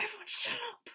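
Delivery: high, loud mock shout; retold yell. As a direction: call out to hush a crowd. Retold yell to hush the crowd.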